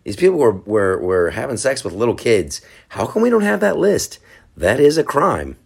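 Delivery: high, indignant mock outrage; high-pitched incredulous demand; quoting a UFC fighter. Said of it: "He's like".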